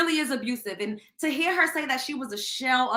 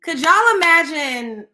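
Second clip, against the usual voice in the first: drawn out and louder, leaning on y'all; slow emphatic appeal; 3 semitones higher, 8 dB louder, slower than usual. Real, not acted. Host asks viewers to picture her pain, slow and emphatic. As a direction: press the question on y'all slowly.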